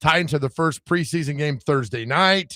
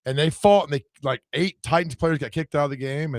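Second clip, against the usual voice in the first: ordinary talk, nothing marked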